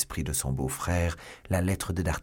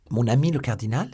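higher, louder exclamation of surprise; startled cry; 5 semitones higher, 6 dB louder, faster than usual. A narrator's reading. Milady caught out by her brother-in-law, startled cry. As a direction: throw the words back in alarm. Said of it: "s'écria Milady".

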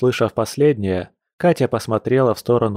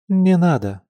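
higher, taken-aback refusal; astonished objection; startled protest; 8 semitones higher than usual. A narrator's reading. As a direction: protest in surprise, pitch lifted. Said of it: "поражённо запротестовала Катя".

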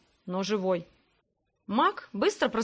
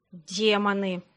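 hissed; 3 semitones lower, much slower than usual. Acted. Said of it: "прошипел Грег и поёжился".

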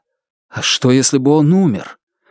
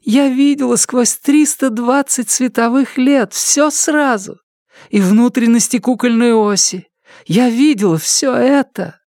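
much higher, awed and breathless; awed high female voice; 12 semitones higher than usual. Acted. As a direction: voice full of wonder, pitched up. Awed character continues speaking.

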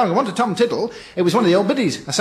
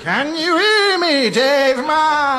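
high, slow, wailing ghostly call; spooky ghost voice; 5 semitones higher, much slower than usual. Acted. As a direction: moan it like a ghost haunting a graveyard. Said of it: "doing his spooky ghouly voice".

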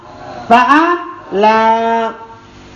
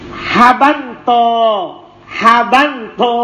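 drawn out syllable by syllable; "Habanto" pronounced slowly so students can copy it; slow, drawn-out model word; slower than usual. Shown as a demonstration. (mengucapkan "Habanto" perlahan sebagai contoh)

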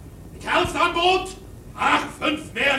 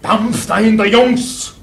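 much louder, lower urging shout; shouting at rowers; 6 semitones lower, 9 dB louder than usual. Acted. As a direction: yell it over the sea to the crew. Mate urges the crew. (tiefer, sehr lauter Ruf an die Ruderer)

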